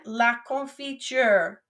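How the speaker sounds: La confiture said slowly for the class to repeat; teacher modelling La confiture slowly; slow, clear model word. Shown as a demonstration. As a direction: sound it out slowly for students. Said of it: "I'll say the word, then you say it".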